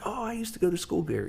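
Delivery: high-pitched retold exclamation of fond discovery. Rock star spotting his old school from the van, excited. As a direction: act out delighted recognition, pitched well up.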